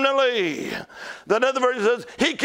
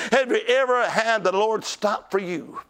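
normal talking voice

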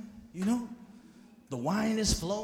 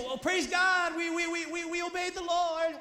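higher, stammering nervous excuse; flustered king; 8 semitones higher than usual. Retold or imitated. Caught king stammering to the prophet, nervous. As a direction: scramble for an excuse.

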